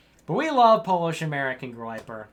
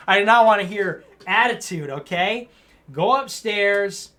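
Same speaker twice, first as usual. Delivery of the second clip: louder, hammed-up stern telling-off; mock parent scolding; 4 semitones higher, 6 dB louder than usual. Host mock-scolds a super chatter for back talk, loud hammed-up anger. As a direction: scold him like a fake angry dad.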